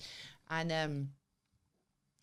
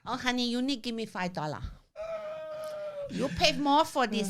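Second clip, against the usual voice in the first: put-on Thai masseuse accent; playful put-on Thai accent; 7 semitones higher than usual. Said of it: "Your Thai accent safe space".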